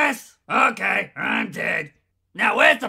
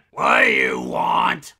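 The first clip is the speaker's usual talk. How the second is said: much lower, gruff shouted question rising at the end; gruff demand. Acted. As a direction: bark it out like a fed-up soldier. Grumpy voice bank.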